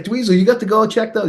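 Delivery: high, enthusiastic recommendation; retold keen insistence; excited urging.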